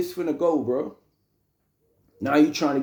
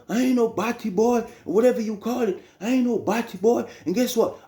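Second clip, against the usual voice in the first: indignant retort; 7 semitones higher than usual. Retold or imitated. Uncle angrily denies the insult.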